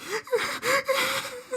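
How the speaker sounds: a high, wailing mock sob imitating her crying. Imitating a crying friend's sob for the group.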